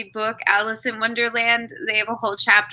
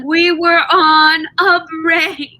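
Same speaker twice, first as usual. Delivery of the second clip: loud, high, drawn-out mock protest; Ross; 7 semitones higher, 8 dB louder, much slower than usual. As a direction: protest indignantly, stretching every word. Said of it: "like, Ross and Rachel and Friends".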